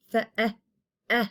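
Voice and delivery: eh said alone as a short open vowel; isolated short eh. Shown as a demonstration. Teacher isolating the short E for students. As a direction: clip a short eh sound. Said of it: "the short vowel sound like eh".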